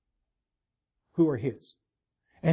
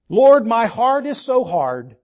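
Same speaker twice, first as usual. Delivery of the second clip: a louder, slow lament to the Lord; pleading believer; 3 semitones higher, 7 dB louder, slower than usual. Acted. Believer complaining to the Lord, mild lament. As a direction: plead it like a burdened heart. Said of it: "believer's complaint voiced to God".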